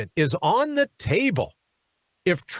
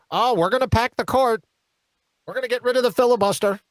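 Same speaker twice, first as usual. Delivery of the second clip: mock Schumer; 4 semitones higher, faster than usual. Mimicking Schumer's threats.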